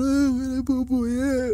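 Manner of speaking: imitating crying kid. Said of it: "ويبكون".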